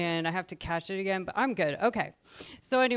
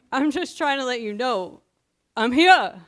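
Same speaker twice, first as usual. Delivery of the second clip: quoting the guy, emphatic; 4 semitones higher, 8 dB louder than usual. Retold or imitated.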